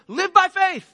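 loud, high ringing call to faith; a fervent preacher's cry; preaching shout. Real, not acted. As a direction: hit it hard like the sermon's peak. Preacher's climactic exhortation.

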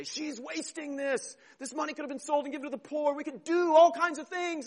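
persnickety complaint. Acted. Disciples objecting to Mary's anointing, persnickety griping. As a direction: fuss and object in a high put-on voice. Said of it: "they get persnickety with her".

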